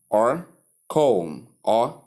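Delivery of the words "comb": "comb" with long O, for come; mispronunciation demo. Shown as a demonstration. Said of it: "Common error".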